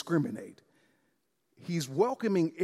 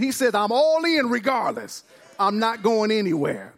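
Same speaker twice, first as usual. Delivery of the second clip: raised pitch, driving sermon delivery; impassioned preaching; 6 semitones higher than usual. Real, not acted. Preacher declaring God's commitment to the church, impassioned preaching. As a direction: build to an emphatic declaration.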